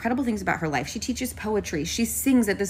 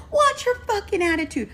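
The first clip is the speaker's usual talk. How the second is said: high-pitched angry retort; retold yell, pitched way up; screaming back. Pedestrian yelling back at the bus driver, high mock scream. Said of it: "so I'm screaming back".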